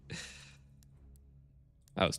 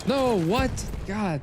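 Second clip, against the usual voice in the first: startled disbelief; 13 semitones higher, much slower than usual. Real, not acted. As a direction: react like the game cheated you. Surprised in game.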